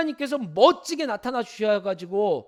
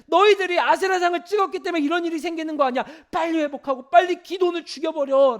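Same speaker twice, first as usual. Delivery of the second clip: higher, louder taunting jeer with a laugh; mocking crowd; 7 semitones higher, 6 dB louder than usual. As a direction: sneer like the scornful townsfolk. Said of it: "일반 사람들은 뭐라고 생각할까요".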